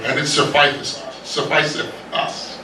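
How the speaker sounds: fading to a near whisper; hushed repetition. Acted. Preacher voicing Philip, dropping to a whispered repeat. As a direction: let the words trail off breathlessly.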